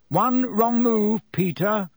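slow, high-pitched warning; threatening. A narrator's reading. Lupin warning Pettigrew at wand point, threatening. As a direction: draw it out as a threat.

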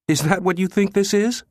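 very high, incredulous question; scornful disbelief. A narrator's reading. Jabba derides the director's guess, high and incredulous. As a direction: pitch way up, mocking the idea. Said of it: "His harsh guffaw resonated".

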